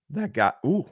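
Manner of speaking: a real little crack in the voice. Real, not acted. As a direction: pitch cracks up on ooh. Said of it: "oh no, little voice crack".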